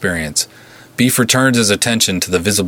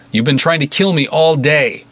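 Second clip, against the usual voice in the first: calling out; 7 semitones higher than usual. A narrator's reading. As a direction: challenge them from the room. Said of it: "Beef called out".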